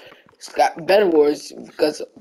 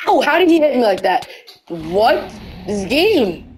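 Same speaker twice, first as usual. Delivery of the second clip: raging gamer; 6 semitones higher than usual. Real, not acted. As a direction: cry out, stung and indignant. Hit in game, outraged.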